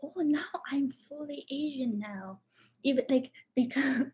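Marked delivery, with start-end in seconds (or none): 0.0-2.4 hushed, half-joking delight; softly whispered mock relief; whispered happy quip. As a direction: whisper it as a pleased little secret. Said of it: "I said".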